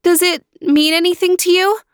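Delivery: put-on high, pleading young girl voice; high young sister pleading; girl calling out. A narrator's reading. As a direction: call to your brother through the wall. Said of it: "she asked".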